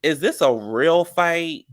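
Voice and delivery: skeptical. Retold or imitated. Recapper doubting the fight is real, slow incredulous disbelief. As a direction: drag it out in disbelief. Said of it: "And I was just like".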